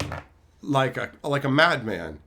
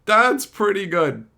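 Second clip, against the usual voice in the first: laughing approval; 9 semitones higher, 4 dB louder than usual. Retold or imitated. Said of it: "And I was like".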